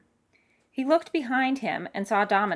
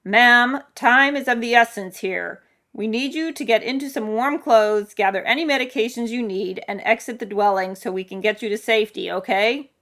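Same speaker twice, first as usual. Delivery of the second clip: loud, commanding rescue worker; big booming official voice; 4 semitones higher, 7 dB louder than usual. Acted. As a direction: project firm instructions over a storm. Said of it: "a voice boomed out".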